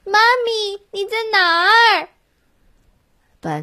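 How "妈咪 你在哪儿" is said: yelling across the room. A narrator's reading. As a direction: shout for Mommy across the hall. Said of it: "大声喊".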